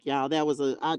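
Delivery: usual speaking voice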